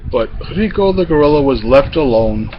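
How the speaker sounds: plain reading voice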